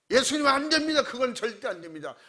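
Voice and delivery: loud high cry that settles on the second line; loud protest. Retold or imitated. Peter protests to Jesus, a loud high cry. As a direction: blurt out a flat refusal. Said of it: "아주 큰 소리로".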